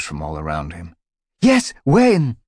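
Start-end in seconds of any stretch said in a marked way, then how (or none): 1.4-2.4 much higher, loud eager cry; eager crowd cry. Acted. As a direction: call out eagerly, very high. Other mews rose all around.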